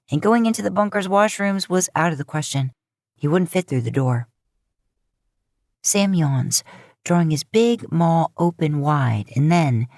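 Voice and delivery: ordinary delivery, nothing marked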